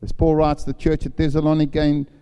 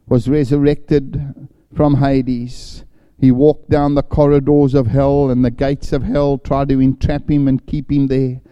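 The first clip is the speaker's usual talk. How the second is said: full-force preaching; 6 dB louder than usual. Acted. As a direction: proclaim the resurrection to the whole hall.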